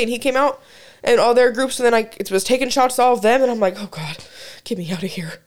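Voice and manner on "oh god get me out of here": put-on dropped low and soft, a drunk plea to escape; low groaning mutter. Retelling a drunken party, a low groan to herself. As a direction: groan it under your breath.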